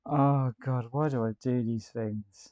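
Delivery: loud, laughing "oh God"; laughing exasperation. Real, not acted. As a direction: laugh at yourself, a bit exasperated. Laughing at own mistake.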